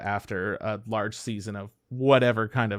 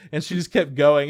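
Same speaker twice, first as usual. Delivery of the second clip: ordinary delivery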